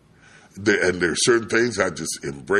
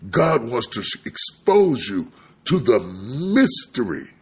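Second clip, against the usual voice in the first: raised, measured pulpit chant-like repetition; emphatic preaching; 6 semitones higher, 4 dB louder than usual. Acted. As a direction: proclaim it phrase by phrase. Preaches at full force.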